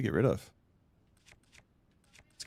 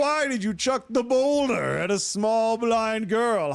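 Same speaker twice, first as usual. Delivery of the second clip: slow, high, loud mock indignation; overacted shocked accusation; mock outrage; 10 semitones higher, 5 dB louder, slower than usual. Mock outraged question in game.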